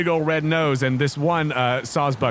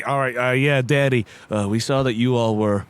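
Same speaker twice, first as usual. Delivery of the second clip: hesitant, lower deer voice; lightly voiced shy deer; 4 semitones lower than usual. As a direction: explain nervously to the visitors. GM voices Randy the Sawsbuck.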